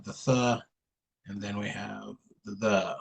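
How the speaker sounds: th said soft and voiceless, then the said hard and voiced; th voiceless, the voiced, tongue between the teeth; two th sounds. Shown as a demonstration. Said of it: "two different sounds for T H".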